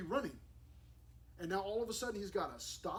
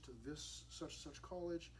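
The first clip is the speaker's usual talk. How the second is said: normal talking voice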